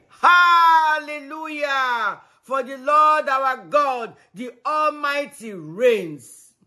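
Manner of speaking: slow, loud, soaring hallelujah; preaching proclamation. Acted.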